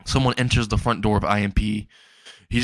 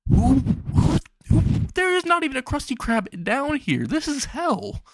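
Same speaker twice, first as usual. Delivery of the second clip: very high squeaky outraged rant; shrill-voiced cartoon indignation; outraged squeak. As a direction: squeal it out in exasperation. Luna ranting at prank caller.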